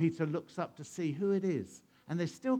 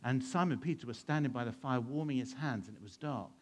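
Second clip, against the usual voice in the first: usual speaking voice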